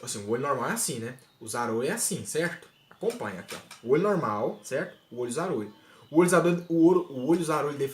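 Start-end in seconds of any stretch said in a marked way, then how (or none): none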